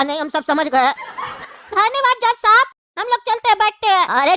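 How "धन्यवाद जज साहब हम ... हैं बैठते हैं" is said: high, squeaky put-on witness; a pipsqueak whining to the judge; whiny witness voice.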